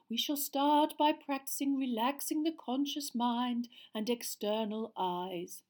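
slow, high, dreamy mystic voice; dreamy seer. A narrator's reading. Seer speaking dreamily to her class. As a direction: float each word out like a seer in a trance. Trelawney voice held.

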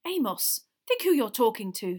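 loud, understated angry rebuke. A narrator's reading. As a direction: pull a colleague up sharply. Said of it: "very angrily".

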